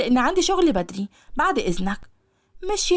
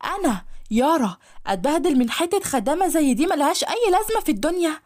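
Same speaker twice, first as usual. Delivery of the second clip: normal talking voice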